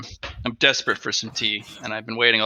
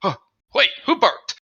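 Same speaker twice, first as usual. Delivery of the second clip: dog voice; 10 semitones higher, 3 dB louder than usual. Retold or imitated. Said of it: "He's like".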